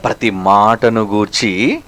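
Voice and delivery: low, soft and slow, ప్రతి మాటను గూర్చి; hushed repeat. Shown as a demonstration. Preacher repeating the verse to his flock, low and slow. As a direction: drop to a grave near-murmur. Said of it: "every word counts".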